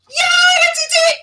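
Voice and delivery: delighted shout of success; triumphant cheer. Real, not acted. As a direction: cheer at your own tech win. Tech worked, guest brought on.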